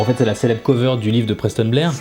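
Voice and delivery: ordinary delivery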